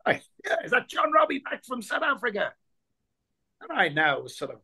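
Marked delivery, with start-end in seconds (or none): 0.4-2.5 a higher broad Dublin voice calling across; broad Dublin accent. Retold or imitated. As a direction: tease him in a broad Dublin voice. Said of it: "broad Dublin accent".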